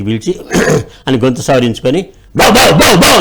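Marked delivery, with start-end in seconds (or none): 2.4-3.2 loud, higher bow-wow barks; mouse barking like a dog. A narrator's reading. Mother mouse scares the cat away with loud barks. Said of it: "అని అరిచింది".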